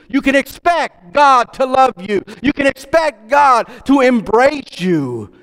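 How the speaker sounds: full-force preaching. Acted. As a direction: build the sermon to a fervent climax.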